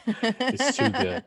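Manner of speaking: high, slow, squealing through laughter; laughing delight. Real, not acted. Co-host cracking up over the chicken picture, laughing.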